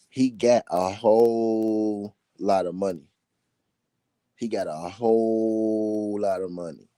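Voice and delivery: "whole" held very long; drawn-out emphasis. Real, not acted. Host insisting Tank has a whole lot of money, drawn-out emphasis. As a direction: stretch out "whole" slowly to hammer the point.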